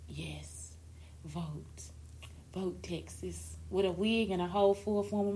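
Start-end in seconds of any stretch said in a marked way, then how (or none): 0.1-3.5 breathy stage whisper, slow at the end; hushed pretend campaign whisper. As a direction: lean in and whisper it secretly. Imagined turning round at church.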